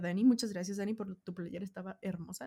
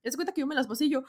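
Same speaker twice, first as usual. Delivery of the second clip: normal talking voice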